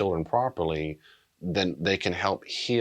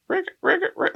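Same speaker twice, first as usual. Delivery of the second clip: high squeaky faucet noise, in short bursts; faucet squeak; 3 dB louder than usual. Retold or imitated. Counselor imitating a faucet barely letting tears out, high squeaks. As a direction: imitate a stiff, squeaking tap. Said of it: "it's a false that goes".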